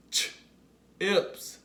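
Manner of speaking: "Ch-ips" split, a long pause after "ch"; sounded-out word. Shown as a demonstration. Teacher leads the class to say it along, "Ch-ips" split in two. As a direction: isolate the "ch", then release "ips". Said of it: "Say it with me".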